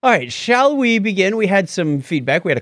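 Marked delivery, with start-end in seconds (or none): none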